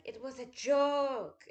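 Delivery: wife mimicking her German husband's terse reply; imitating the husband. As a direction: drag out a smug deadpan excuse. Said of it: "in that dickish German way".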